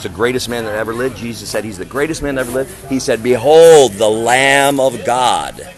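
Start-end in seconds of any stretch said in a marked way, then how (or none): none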